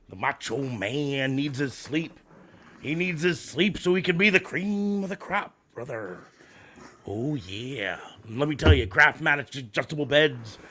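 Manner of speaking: raspy wrestling promo growl; Macho Man Randy Savage. Acted. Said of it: "cut a promo for is Macho Man Randy Savage".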